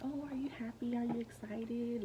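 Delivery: cheery doctor. Retold or imitated. Doctor at the ultrasound asks brightly, lightly voiced. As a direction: coo it like a bubbly nurse. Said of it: "She was like".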